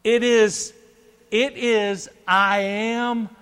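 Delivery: high, loud declaration, broken by weighty pauses; preaching proclamation. Acted. Preacher declares God's name to congregation, proclaiming loudly. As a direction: proclaim each phrase at full voice.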